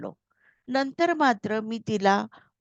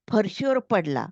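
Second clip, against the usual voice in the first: ordinary delivery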